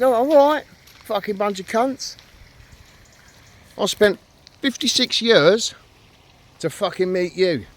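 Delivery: very high, loud, slow hammed-up rant; mocking the stranger who moaned about the smell, in a hammed-up rant; mock furious rant. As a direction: overdo his angry outburst to mock him.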